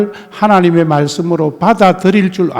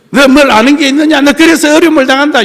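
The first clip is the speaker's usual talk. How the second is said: higher, louder, fast scolding; scolding rebuke; 7 semitones higher, 4 dB louder, faster than usual. Retold or imitated. Pastor mimicking a scolding friend, fast reproach. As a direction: snap at the sufferer like Job's friend. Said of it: "욥의 친구처럼 하면".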